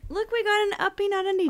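high pet-talk; baby talk to dog. Pretend. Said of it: "I say uppy".

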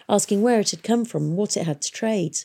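ordinary delivery, nothing marked

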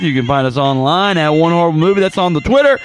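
loud promo sign-off voice; podcast outro. Acted. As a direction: announce it like an ad read.

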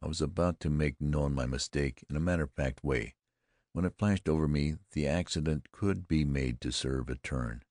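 ordinary delivery, nothing marked

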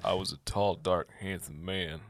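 slow, low sultry read. Acted. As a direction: breathe it out slowly, seductive. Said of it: "you gotta have the".

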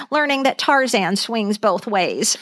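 ordinary talk

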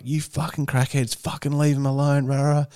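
high-pitched, held-back imitation of a yell; muted mock yelling. Running over to confront crackheads, muted mock yell. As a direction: re-enact your angry outburst without the volume. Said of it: "I just started yelling".

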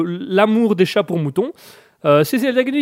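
ordinary talk, nothing marked

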